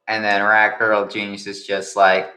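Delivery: ordinary talk, nothing marked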